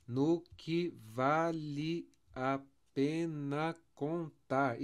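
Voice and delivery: slow, syllable by syllable; evenly spaced, deliberate scansion; separated syllables. Shown as a demonstration. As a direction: lay out each beat of the verse. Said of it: "Deixa eu separar aqui".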